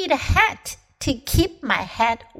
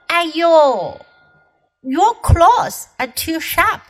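sudden pain cry; 5 semitones higher, 5 dB louder than usual. Acted. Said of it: "突如其来的疼痛".

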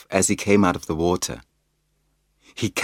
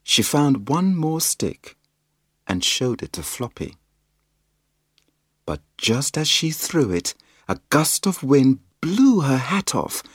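usual speaking voice

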